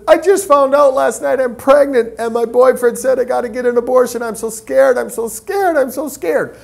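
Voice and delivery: high, distraught imitation of a sobbing woman; retold tearful panic. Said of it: "She breaks down sobbing and crying".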